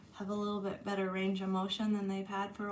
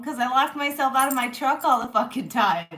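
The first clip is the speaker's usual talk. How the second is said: loud, fed-up exclamation; exasperated; 4 semitones higher, 11 dB louder than usual. Real, not acted. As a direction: vent the frustration loudly. Exasperated about locking herself out.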